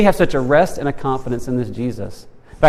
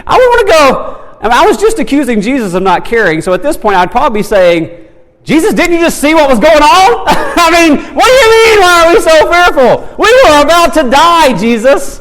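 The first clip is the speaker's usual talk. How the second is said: high, loud, frantic protest to Jesus; panicked Peter; 12 semitones higher, 8 dB louder than usual. Acted. Peter protesting to Jesus mid-storm, high and frantic. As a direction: cry out like a terrified disciple in the storm.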